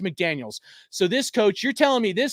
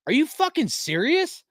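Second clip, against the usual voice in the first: much higher, incredulous pitch jump; outraged disbelief; 8 semitones higher than usual. Real, not acted. Streamer reacting to a chat comment, outraged disbelief. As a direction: hit it like you can't believe it.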